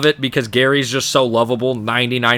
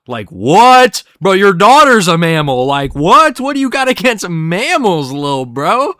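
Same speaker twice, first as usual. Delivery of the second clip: louder, exasperated questioning; indignant outburst; 4 semitones higher, 5 dB louder than usual. Real, not acted. Vlogger reacting to Krabs's remark, loud and indignant. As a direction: call him out in disbelief.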